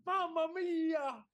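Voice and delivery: high, drawn-out mock Italian accent. Italian criminal caught shopping in Tesco's, hammed-up Italian accent. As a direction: stretch it out slowly in a hammy Italian accent. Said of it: "I mean, the accent is something else".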